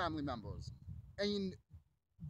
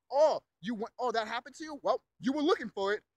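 loud, higher mocking imitation; mock victim-blaming; 4 semitones higher, 7 dB louder than usual. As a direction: imitate a scornful victim-blamer, punched loud.

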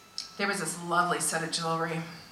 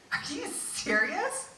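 high and slow, stunned; amazed disbelief; 11 semitones higher, 4 dB quieter, much slower than usual. Retold or imitated. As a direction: stretch it out, astonished. Disbelief at the price.

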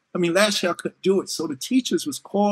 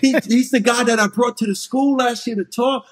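proud dad; 4 semitones higher, 6 dB louder than usual. Retold or imitated. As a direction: boast it to the kid. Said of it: "and I go".

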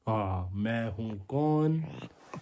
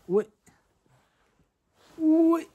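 high, loud call of oye; 14 semitones higher, 5 dB louder than usual. Real, not acted. Calling the little one to look: high, loud call. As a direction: sing out oye to get his attention.

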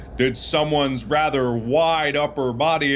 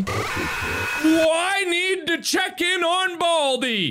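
high shouted call; much slower than usual. Real, not acted. Calling out high and loud.

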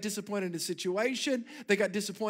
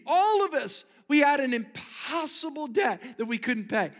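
preaching; 5 semitones higher, 3 dB louder than usual. Acted. Preaching climax.

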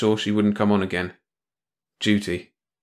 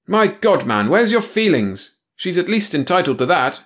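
heated protest; 10 semitones higher, 5 dB louder than usual. Acted. Tony protests.

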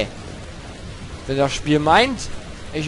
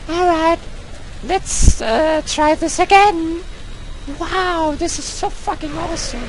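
high put-on English girl voice, raised all through; a falsetto girl persona ranting in English at the game; 9 semitones higher than usual. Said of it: "I'm playing the English girl right now".

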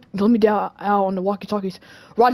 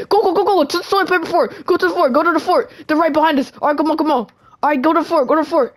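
high, frantic escape call; urgent panicked urging; 6 semitones higher than usual. Pretend. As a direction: hurry your friends to the hideout. Fleeing to the fort.

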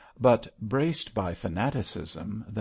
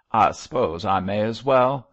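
a louder, grudging concession; scowling; 8 dB louder than usual. A narrator's reading. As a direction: give in with a scowl. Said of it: "said Mosk, scowling".

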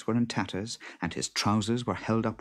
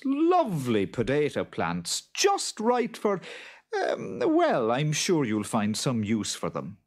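higher, swooping sales-talk voice; slyly obliging farmer; 6 semitones higher than usual. A narrator's reading. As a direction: talk up the crop, then falter and recover. Farmer slyly hands over useless tops.

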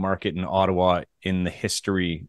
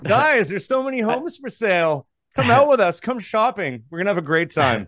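very high, excited mock invitation; rally the buyers in a put-on squeal of enthusiasm; over-the-top realtor pitch; hammed-up sales hype; 10 semitones higher, 3 dB louder than usual.

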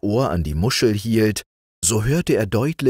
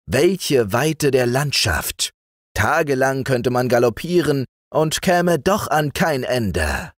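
tempting sorcerer; 6 semitones higher than usual. Acted. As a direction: lure the boy out with wonders. Zacher tempts the boy with the world.

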